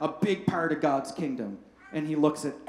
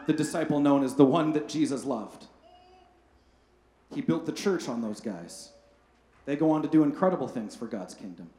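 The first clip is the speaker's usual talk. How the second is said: ordinary talk, nothing marked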